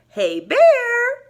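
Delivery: high, loud call across the field. Acted. Mouse calling Bear out of the woods, high and loud. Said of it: "called Mouse".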